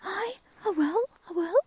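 high, loud eager cuckoo; eager promise. Acted. Cuckoo eagerly promising crow to try. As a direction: promise it with all your heart. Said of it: "says Frilly".

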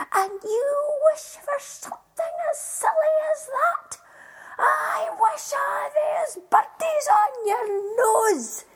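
high, loud, exasperated scolding that builds to a shout; wife explodes at her husband's wasted wish, shrill shouting; furious wife. Acted. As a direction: lash out at the silly husband. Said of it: "she said with gritted teeth".

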